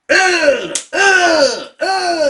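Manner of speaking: high loud yelps as hair is pulled; short panicked cries; pained cries. Retold or imitated. Girl grabbed by hair.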